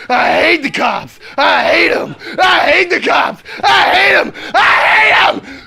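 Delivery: escalating furious shout; building rage. Real, not acted. Building rant.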